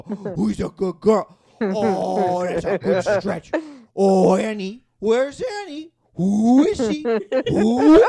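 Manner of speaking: cooing dog-owner voice with long stretched vowels; dog-talk voice. Acted. Comedian showing how he talks to his dog, cooing and drawn-out. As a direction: gush slowly at a pet, swooping up on the questions. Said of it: "talk to our dog in that specific voice".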